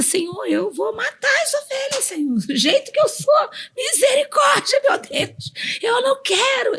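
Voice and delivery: high, crying plea that breaks into a shout; crying prayer. Retold or imitated. Retelling her anguished prayer to God, a high crying shout. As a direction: cry out to God, voice cracking high.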